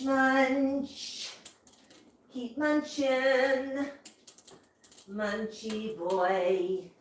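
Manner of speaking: soft, drawn-out pet talk; hushed, slow singsong murmur to a pet; soft cooing. Real, not acted. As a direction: coo slowly and quietly to a munching bird. Cooing over eating bird.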